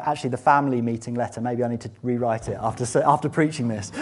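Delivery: ordinary talk, nothing marked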